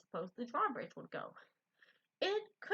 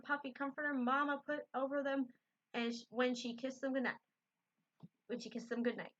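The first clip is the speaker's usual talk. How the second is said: plain reading voice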